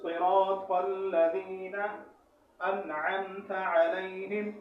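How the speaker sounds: very slow, drawn-out model recitation; correct pronunciation. Shown as a demonstration. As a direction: recite each letter fully, holding the long vowels. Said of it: "اس آیت کا صحیح تلفظ".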